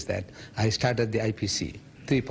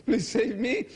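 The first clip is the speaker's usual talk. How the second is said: high-pitched retold plea; begging; 8 semitones higher than usual. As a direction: plead for rescue in a raised voice. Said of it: "I go around begging".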